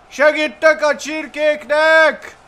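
high, loud, drawn-out rallying shout; playful heroic war cry; battle cry. Pretend. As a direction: call out slowly as you charge in. Battle cry joining the fight.

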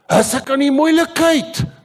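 high, loud yelled Afrikaans question; screaming it out. Retold or imitated. Startled man on the street yells back at the preacher, screaming. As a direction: yell it out at full pitch. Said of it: "he was screaming it out".

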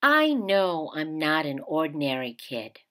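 gentle picture-book read-aloud; reading to children. A narrator's reading. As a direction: read it like story time for kids. Teacher reads picture book aloud.

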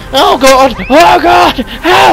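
panicked shout. Real, not acted. Player hiding under chair screams at monster, terrified yelling. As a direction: yell in terror.

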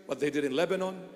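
ordinary delivery

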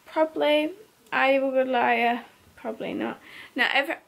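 normal talking voice

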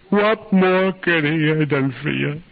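high, drawn-out screamed question; anguished scream. Retold or imitated. Grieving mother demanding an answer, screamed in anguish. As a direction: scream the question slowly, blazing with anger. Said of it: "looked at me and she screamed".

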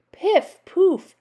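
high, loud magic words; two high popped exclamations; crying out. A narrator's reading. Witch tossing food into the cauldron, crying out. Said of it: "cried Naggy Witch".

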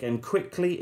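usual speaking voice

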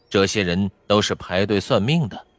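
usual speaking voice

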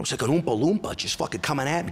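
normal talking voice

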